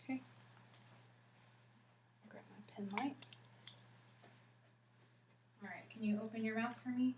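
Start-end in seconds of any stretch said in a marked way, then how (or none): 2.3-3.2 whispering to herself; breathy whispered self-talk; whispered aside. Real, not acted. Aside to self while examining.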